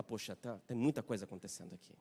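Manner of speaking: soft, low, deflated aside; quiet sigh. Acted. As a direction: sigh it out, barely voiced. Listener sighs, overwhelmed.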